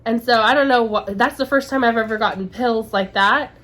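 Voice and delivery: ordinary talk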